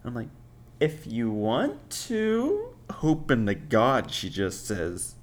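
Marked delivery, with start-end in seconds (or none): 0.8-2.8 slow, drawn-out, rising high at the end; feigned reluctance. Retold or imitated. He hopes date will leave, feigned reluctance. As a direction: stretch it into a high, noncommittal question.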